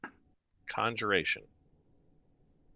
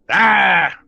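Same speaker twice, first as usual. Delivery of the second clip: loud high monster yell; creature shout; 9 semitones higher, 15 dB louder than usual. Acted. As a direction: shoo them off with one bark.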